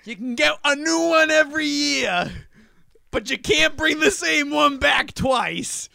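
stadium vendor hawking. Pretend. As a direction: hawk refills to the crowd. Mock stadium vendor banter.

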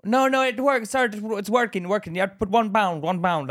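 imitated shopkeeper brushing off a complaint; insistent shop lady. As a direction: talk over the customer, high and repetitive. Lady arguing back over the complaint.

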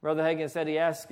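ordinary delivery

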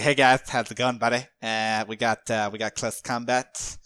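loud, hammed-up Flyrant accent; mock Flyrant accent, loudly buddying up; put-on Flyrant accent. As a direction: play the monster with a silly, booming accent. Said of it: "why Flyrants talk with this accent".

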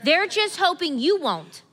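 loud, high, fervent preaching; preaching at full force. Real, not acted. Pastor rising to a climax before the church, loud and high. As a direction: drive it home to the congregation.

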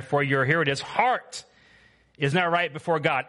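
loud, high preaching of scripture; preaching at full force. Acted. Preacher reading Acts to the church with full force. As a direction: proclaim it to the back row.

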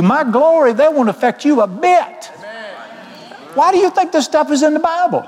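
high, ringing preacher's cry; preaching at full force. Real, not acted. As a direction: lift the whole congregation with a soaring call. Preaching climax.

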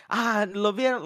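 high, loud mocking call of a taunt; mock taunt in Spanish. Imitating Moreno taunting Figueiredo, high and loud mockery. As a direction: toss out the Spanish jab like a heckler. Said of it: "like, in Spanish".

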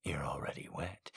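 low, soft, seductive man; seductive whisper. Acted. Seductive male voice.

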